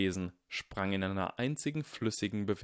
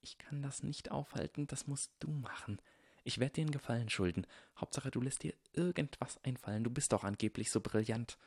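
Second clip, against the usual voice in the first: soft, higher, urgent whispery voice; whispering; 5 semitones higher, 5 dB quieter than usual. A narrator's reading. Draco begs Harry to stop the fight, whispering. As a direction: plead secretly under your breath. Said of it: "flüsterte Draco".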